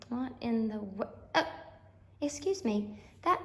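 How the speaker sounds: ordinary talk, nothing marked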